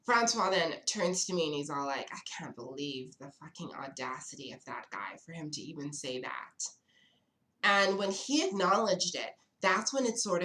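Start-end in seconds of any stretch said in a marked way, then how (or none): 2.1-6.7 hushed, unvoiced whisper. Date whispering his outrage about the waiter across the table. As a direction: whisper it so the waiter can't hear.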